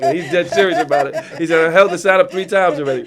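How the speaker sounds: loud, laughing through the words; amused, laughing. Real, not acted. Guest joking about the five-minute sign, real laughter in the voice. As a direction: crack up while telling on the strict timekeeper.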